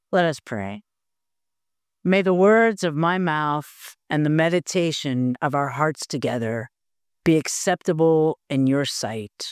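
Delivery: a pastor's solemn prayer before the sermon; opening prayer. Acted.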